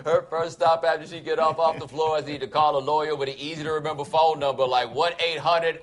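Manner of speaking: ordinary talk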